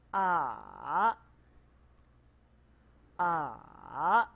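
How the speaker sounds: each A dipping down then back up; third tone demo. Shown as a demonstration. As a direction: fall then rise on each A. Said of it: "turun kemudian naik".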